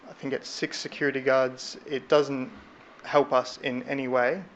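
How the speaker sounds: usual speaking voice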